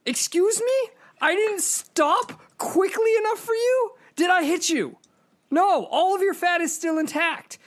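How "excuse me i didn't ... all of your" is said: high, incredulous mock shout, slowed down; indignant outburst. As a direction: throw it back at her in disbelief. Outraged rhetorical retort to woman.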